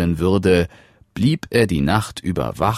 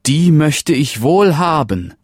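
higher and louder, delighted; happy donkey prince; 9 semitones higher, 5 dB louder than usual. Acted. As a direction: say yes with real glee. The donkey accepts happily.

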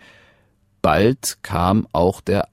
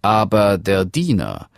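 ordinary delivery, nothing marked

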